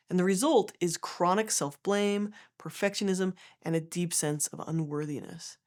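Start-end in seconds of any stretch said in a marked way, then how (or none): none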